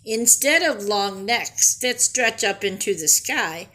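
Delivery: ordinary talk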